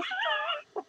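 high, trailing cackle. Real, not acted. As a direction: crack up in high laughter. Cracks up at own looks.

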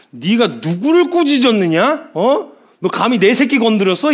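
high, loud angry challenge; stern warning. Acted. Preacher voices God rebuking the king who touched his child, stern fury. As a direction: demand an answer like a furious father. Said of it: "주님이 엄히 경고를 하시는데".

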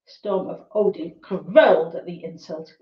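ordinary delivery, nothing marked

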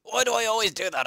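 high, loud frustrated outburst; frustrated yell. Real, not acted. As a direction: cry out at your own mistake. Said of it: "I'm angry now".

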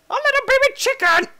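very high, loud squeal; squealed delight. Real, not acted. Player spotting a baby chicken, squealed delight.

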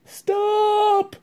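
high, loud shout to stop the fighting. Acted. The chief frog stopping the quarrelling animals, a high loud shout. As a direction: cut through the noise with one cry. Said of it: "the chief frog shouted out".

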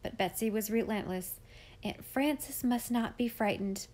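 ordinary delivery

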